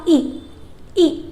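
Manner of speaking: "ई" said as a short clipped i; short i sound. Shown as a demonstration. Teacher showing kids the short "ई" sound. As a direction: say "ई" short, like the i in ring. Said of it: "आई का short sound".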